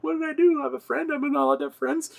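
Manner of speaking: high, whiny disbelief; exasperated protest. Retold or imitated. Boyfriend protests to his yelling girlfriend in a high, exasperated voice. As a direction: plead your innocence up in a high register.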